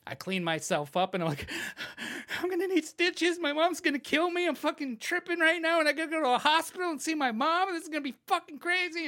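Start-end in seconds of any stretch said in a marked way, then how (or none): 2.4-9.1 high-pitched frantic worrying; acted-out anxious babble; panicked rambling. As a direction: spiral into panic, speeding up. Retelling his panic over stitches.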